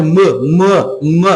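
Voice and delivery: "m" as a short, closed "mâ"; closed vowel. Shown as a demonstration. Teacher isolates the first sound of the word, closed vowel. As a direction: repeat "m" as a closed, cut-off "mâ". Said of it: "com o som mais fechado".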